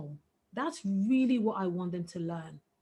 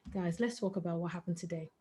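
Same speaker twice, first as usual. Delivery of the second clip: gentle invitation; 5 semitones lower, 9 dB quieter than usual. Retold or imitated. Parent opens calm bedtime talk.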